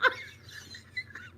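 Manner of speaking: very high, squealed laugh; wheezy laughter. Real, not acted. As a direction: laugh till only a squeak is left. Real laughter at the story.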